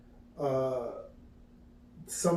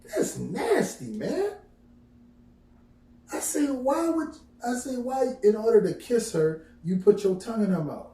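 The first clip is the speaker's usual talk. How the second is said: put-on disgusted kid; 9 semitones higher, 4 dB louder than usual. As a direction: squeal it out like a grossed-out kid. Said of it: "I like".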